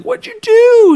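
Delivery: high, loud shriek of a startled mother; alarmed mom. Retold or imitated. Mom discovering the carved pumpkins, shrieking high. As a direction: yelp it out like a panicked mom.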